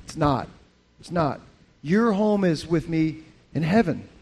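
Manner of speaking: ordinary delivery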